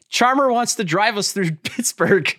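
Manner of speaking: high, indignant pretend-lawyer plea; mock courtroom objection. Player complains to the judge about a game store, mock indignation. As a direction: plead the complaint high and hammed-up.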